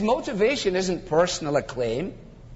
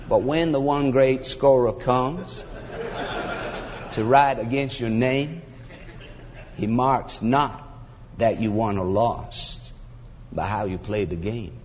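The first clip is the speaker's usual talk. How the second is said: mock-solemn recitation; 7 semitones lower than usual. Preacher reciting the sportswriter's stanza, mock-solemn recitation. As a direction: sink lower line by line, softening at the end.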